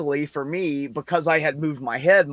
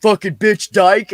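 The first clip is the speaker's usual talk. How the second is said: slow, louder yelled insult from across the room; retold insult shout; 5 semitones higher, 10 dB louder, slower than usual. Kid yelling a slur at the clerk from the door, slow and loud. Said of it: "and he goes".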